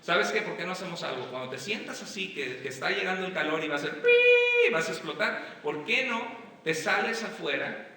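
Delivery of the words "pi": long, loud, high-pitched "piii" beep; imitated whistle. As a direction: sound like a kettle about to blow. Imitates a boiling-over whistle.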